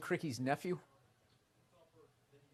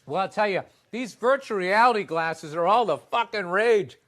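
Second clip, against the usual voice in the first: mock newsreader; 4 semitones higher, 11 dB louder than usual. Mock news anchor answers.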